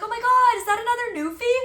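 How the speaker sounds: high, loud, rushed gushing; excited stranger. Retold or imitated. Retelling dog owners running up to her, excitedly. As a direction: imitate an excited dog lover exclaiming.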